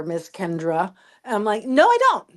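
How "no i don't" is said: defiant retort. Retold or imitated. Mocking pushback on term debate.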